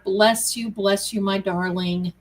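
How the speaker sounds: ordinary talk, nothing marked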